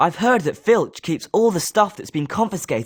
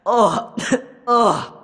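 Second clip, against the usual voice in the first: winded by the hit; coughing groan; 4 semitones higher than usual. Acted. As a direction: cough then a short pained oh. Hit in a duel.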